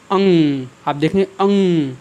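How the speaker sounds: अंग said with a clear nasal ng ending; nasal demo. Shown as a demonstration. Teacher demonstrating to students how अंग sounds through the nose. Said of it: "जैसे मैं उच्चारण करता हूँ किसका".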